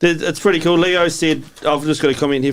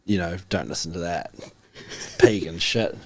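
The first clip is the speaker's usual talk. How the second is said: low, hushed imitated warning; retold disapproving warning; 8 semitones lower, 7 dB quieter than usual. Elder warning a child off Christmas songs, retold low and quiet.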